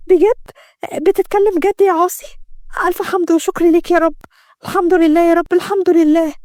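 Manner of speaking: high-pitched, thankful disbelief; overjoyed relief. Acted. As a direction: overcome with relief, pitched well up. Layla learns he can see. (بفرحة وامتنان)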